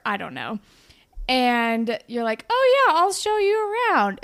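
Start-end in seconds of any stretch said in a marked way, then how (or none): 2.5-4.2 much higher and louder, gushing yes; eager bubbly offer. Retold or imitated. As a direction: jump at the chance to play tour guide. Eager offer to show around.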